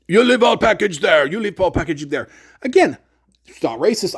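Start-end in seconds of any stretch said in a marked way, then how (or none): 0.1-2.3 shopkeeper mimicked in broken-English foreign accent; mock foreign accent. Shopkeeper told to try again, mock foreign accent. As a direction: put on an unplaceable foreign accent, falling away on the repeat. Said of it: "I don't know what country he was from".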